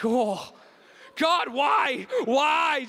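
high, loud wailing why; mock lament. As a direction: cry out to God in hammed-up anguish.

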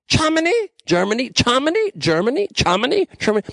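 "Germany" chanted high with a ch sound; repeated mock German. Shown as a demonstration. Host riffing on German pronunciation, chanting "Germany" with ch. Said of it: "say Germany like it's a C H".

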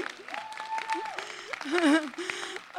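high nervous giggle. Real, not acted. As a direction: laugh off the nerves. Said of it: "I'm really nervous right now".

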